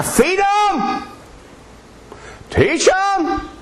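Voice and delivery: high, ringing, drawn-out cry; soaring pulpit call; preaching exclamation. Real, not acted. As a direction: fling it out to the congregation with fervor. Preacher urging the congregation.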